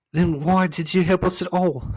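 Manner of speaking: high, loud indignant outburst; angry shout. A narrator's reading. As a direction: shout it angrily at the unhelpful voice. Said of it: "shouted Milo angrily".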